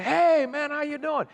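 put-on high, loud call to an old friend; acted, high-pitched hello; hearty greeting. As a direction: hail a long-unseen friend with gusto. Acted greeting to long-unseen friend.

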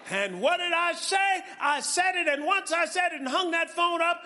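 high, loud, shouted preaching; preaching at full force. Real, not acted.

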